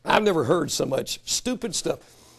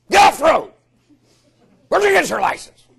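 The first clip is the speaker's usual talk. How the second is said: shouting at other drivers, much higher; road rage yelling; 9 semitones higher, 6 dB louder than usual. Retold or imitated. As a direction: bellow it from behind the wheel.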